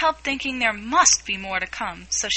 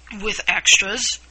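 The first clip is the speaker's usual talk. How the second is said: a little anxious; 4 dB louder, slower than usual. A narrator's reading. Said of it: "a little anxiously".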